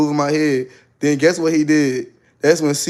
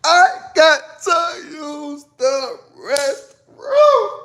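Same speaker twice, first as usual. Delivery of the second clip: drawn-out wailing shout; 13 semitones higher, much slower than usual. Retold or imitated. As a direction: wail it out like a desperate plea. Dramatic repeat of plea.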